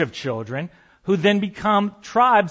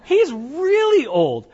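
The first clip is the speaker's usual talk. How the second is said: mock old woman; 9 semitones higher than usual. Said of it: "And she goes".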